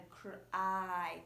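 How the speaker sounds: "cry" slowly, with the long I held. Shown as a demonstration. Teacher isolating the vowel for learners, long I drawn out. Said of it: "it's a long I sound".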